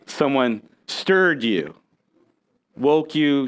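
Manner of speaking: normal talking voice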